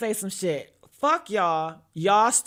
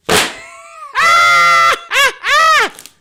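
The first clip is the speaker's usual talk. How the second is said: shrieking laugh; 14 dB louder than usual. Real, not acted.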